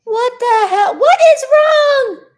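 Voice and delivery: high, loud outburst of outrage; yelling. Real, not acted. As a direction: yell it out, drawing out the end. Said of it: "Oh, I'm yelling again".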